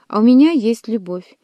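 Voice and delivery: usual speaking voice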